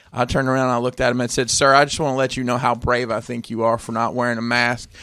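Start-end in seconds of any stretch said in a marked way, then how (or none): none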